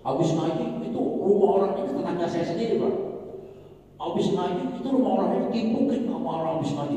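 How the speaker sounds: lightly voiced grievance, pleading; complaining neighbour. Neighbour reports his house was stoned, mildly upset.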